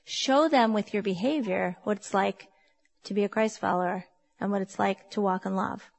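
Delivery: ordinary talk, nothing marked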